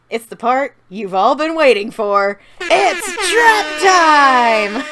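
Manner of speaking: building to a high, drawn-out shout; hyped announcement. Real, not acted. Narrator unveiling the long-awaited trap sequence, hyped announcement. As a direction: announce it like a big main event, stretching the last words.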